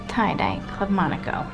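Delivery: ordinary talk, nothing marked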